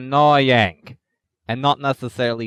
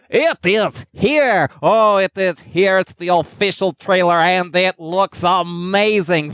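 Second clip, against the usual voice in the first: higher, louder and thrilled; excited; 6 semitones higher, 3 dB louder than usual. Real, not acted. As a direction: gush over the new trailer. Hyped about trailer.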